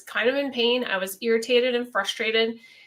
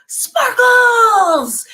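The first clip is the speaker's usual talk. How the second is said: much higher and louder, a bright burst of "Sparkles!"; son's happy shout; 12 semitones higher, 10 dB louder than usual. Retold or imitated. Middle son running into mom's room, loud high shout. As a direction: burst in yelling it like an excited kid. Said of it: "he would go".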